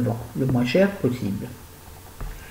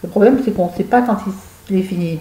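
ordinary talk

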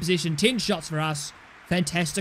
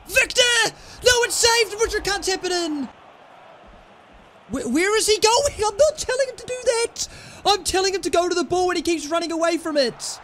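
frustrated yelling; 11 semitones higher than usual. Real, not acted. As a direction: yell at the player in disbelief. Chance missed, player runs away.

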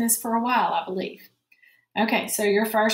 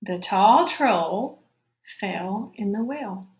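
put-on slow, word-by-word reading for kids; slow model reading of the sentence; slow read-along; slower than usual. Shown as a demonstration. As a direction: stretch each word out so children can follow. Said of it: "We're gonna read just this first sentence".

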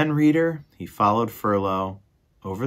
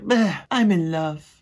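high, slow, dismissive lovesick mouse; mock dreamy Despereaux; 8 semitones higher, slower than usual. Narrator summing up Despereaux, high and lovesick. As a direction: shrug it off, lost in love. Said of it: "Despereaux's like".

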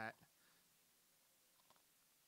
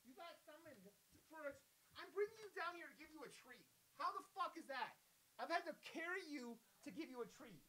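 high, put-upon pet-owner complaint; mock scolding; 9 semitones higher, 14 dB quieter than usual. Real, not acted. Owner bringing the dog down for a treat, high mock scolding.

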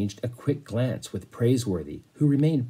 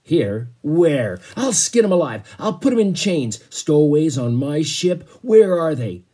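loud, high, outraged roar; angry captain; 5 semitones higher, 7 dB louder than usual. A narrator's reading. Captain raging at stowaways on his ship, angry bellow.

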